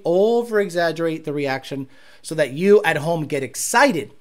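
normal talking voice

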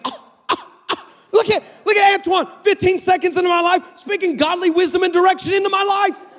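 high, swooning marvel; mock amazement. Man marvelling at the texter's godly advice, gushing high. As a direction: gush in a high put-on voice. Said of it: "I'm thinking".

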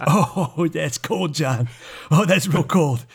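mock-hurt [name].

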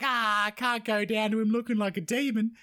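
high put-on demon voice; demon quote. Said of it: "she's like".

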